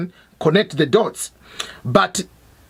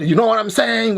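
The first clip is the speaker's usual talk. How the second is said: lightly mocking borrowed slang; put-on American slang. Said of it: "pretending to be".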